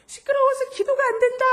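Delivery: high, loud retold yell; angry outburst. Friend scolds her for noisy praying, angry high shout. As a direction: blurt it out at full pitch, fed up.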